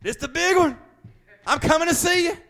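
high-pitched, hammed-up cry that it's the big one; Fred Sanford. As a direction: wail it as a mock dramatic farewell. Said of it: "like old Fred Sanford used to say".

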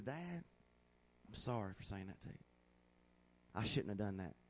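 hushed, remorseful daughter's apology; contrite daughter. Retold or imitated. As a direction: say it low and small like a sorry child.

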